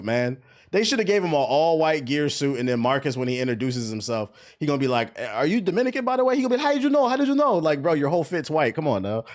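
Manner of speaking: usual speaking voice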